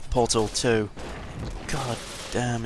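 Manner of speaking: ordinary talk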